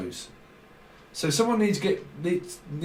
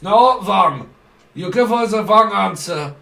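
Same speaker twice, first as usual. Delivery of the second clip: mock stern interrogator; 4 semitones higher, 9 dB louder than usual. Voicing the interrogator's reply.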